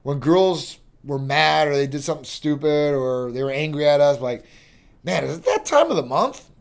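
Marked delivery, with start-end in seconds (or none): 5.0-6.5 joking tease. Retold or imitated. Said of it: "kind of like in a joking manner".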